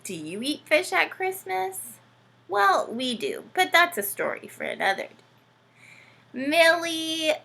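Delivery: usual speaking voice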